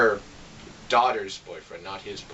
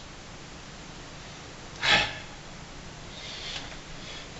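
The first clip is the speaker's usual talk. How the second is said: drawn-out unvoiced exhale; long weary sigh; 8 dB quieter than usual. Real, not acted. Reviewer recalling the endless movie, long tired sigh. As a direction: let all the boredom out in one sigh.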